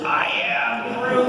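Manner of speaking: put-on high, slow, breathy little-kid Groot; small child in a Groot costume, slow high voice; imitating little girl as Groot. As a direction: coo it like a tiny child in costume. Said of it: "She goes".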